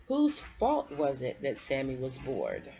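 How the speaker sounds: usual speaking voice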